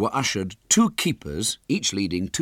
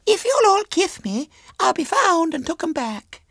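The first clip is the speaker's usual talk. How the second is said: put-on very high, louder child voice; bargaining little girl; 5 dB louder than usual. A narrator's reading. Girl asking all the boys for kisses, childishly coaxing. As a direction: strike a bargain in a squeaky girl's voice.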